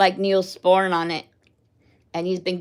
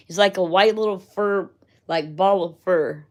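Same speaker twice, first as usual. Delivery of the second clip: usual speaking voice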